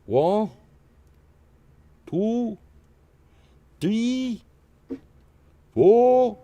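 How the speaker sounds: high, loud German counting, hammed up; mock German accent. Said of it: "That's German".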